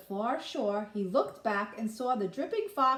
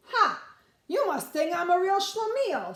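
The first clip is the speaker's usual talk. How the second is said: put-on cocky boy; 9 semitones higher, 6 dB louder than usual. Matzo ball boy mocks the fox.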